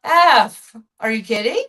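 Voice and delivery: slow, loud mock outrage; outraged disbelief. Retelling the CEO stealing her idea, loud mock disbelief. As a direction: stretch out the shock at the stolen idea. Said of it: "And I was like".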